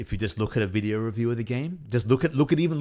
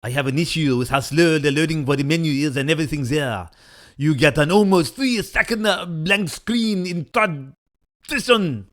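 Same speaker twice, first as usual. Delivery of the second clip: hammed-up French accent, higher and louder; bad French accent; 7 semitones higher, 7 dB louder than usual. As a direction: read the review like a cartoon Frenchman. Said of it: "That's a terrible approximation of your accent".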